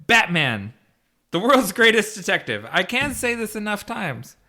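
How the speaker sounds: normal talking voice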